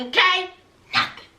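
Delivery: usual speaking voice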